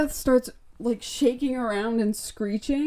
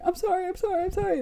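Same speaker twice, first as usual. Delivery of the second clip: high, small pleading voice; put-on timid apology; mock frightened apology; 10 semitones higher, 4 dB quieter, much faster than usual. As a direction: imitate scared boys begging forgiveness, pitched way up. Frightened boys apologizing and fleeing.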